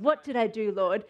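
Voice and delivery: low, sincere plea to the Lord; earnest, low-pitched question; genuine heart. Acted. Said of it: "said with a genuine heart of".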